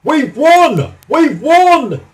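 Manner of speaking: high, loud victory cry; jubilant shout. A narrator's reading. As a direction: shout the win from the rooftop. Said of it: "he shouted".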